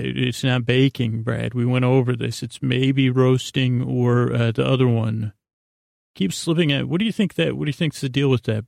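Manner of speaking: ordinary talk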